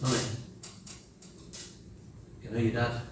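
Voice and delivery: soft, low and gentle; tender. Retold or imitated. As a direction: say it lovingly and quietly. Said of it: "Tender, driven words".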